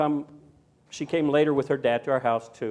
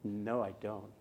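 put-on quiet toddler refusal; 8 semitones lower, 13 dB quieter than usual. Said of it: "And she said".

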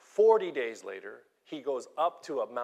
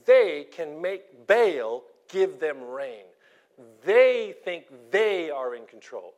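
forceful preaching; 9 dB louder, slower than usual. Real, not acted. Preacher builds to forceful climax.

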